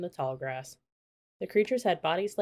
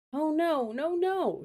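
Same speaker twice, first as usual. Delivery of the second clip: high, rising cries of no; alarmed shout; 10 semitones higher, slower than usual. A narrator's reading. Annie shouting as the herd swims toward the mud, alarmed cries. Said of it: "shouted Annie".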